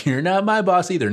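high, loud mock defiance; joking taunt. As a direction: toss it out as a playful taunt. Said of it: "I say the joking around line".

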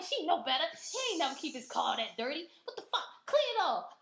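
high, loud indignant rant; indignant outburst building to a loud order; retold anger. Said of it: "I was mad as shit".

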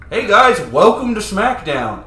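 loud, hammed-up wrestling host greeting; Vince announcer voice. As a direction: boom it out like a ring announcer. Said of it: "was like".

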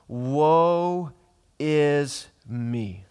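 loud, high, drawn-out "Woe", then falling; cry of woe. Acted. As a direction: cry it out slowly, word by word. Acts out Isaiah's cry in verse five.

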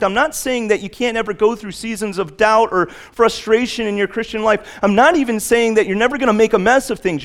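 high, loud preaching at full throttle; fervent pulpit preaching; full-force preaching. Acted. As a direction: preach it hard, driving the point home.

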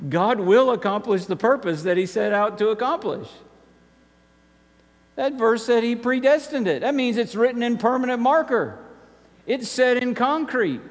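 high-pitched, insistent preaching; emphatic preaching. Real, not acted. Preacher assures the congregation God will accomplish his purpose, emphatic preaching.

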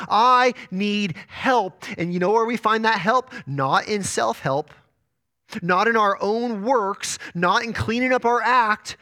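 high, emphatic pulpit delivery; forceful preaching. Real, not acted. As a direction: drive each phrase home like a preacher.

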